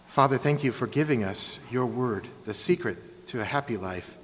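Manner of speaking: hushed prayer before the sermon. Acted. Leads the congregation in prayer.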